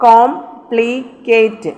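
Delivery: Complicate slowly broken into three syllables. Shown as a demonstration. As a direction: split complicate with short pauses. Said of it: "കേറ്റ് എന്നുള്ള ഒരു syllable".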